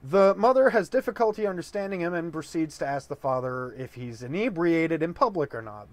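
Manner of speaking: usual speaking voice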